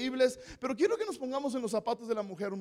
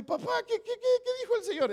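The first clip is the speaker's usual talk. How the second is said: put-on much higher, eager little girl asking; daughter's voice; 12 semitones higher, faster than usual. A father voices his little girl asking about the officer, high and eager. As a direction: pitch up into a curious child.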